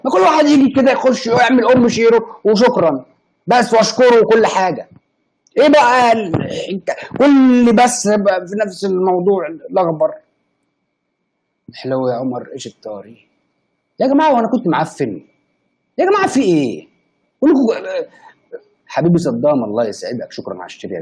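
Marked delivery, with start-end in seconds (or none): 0.0-10.1 high, loud, half-crying whine, slowing at the end; whiny complaint. Real, not acted. Whining about chat comments. 14.0-18.0 indignant appeal. Real, not acted.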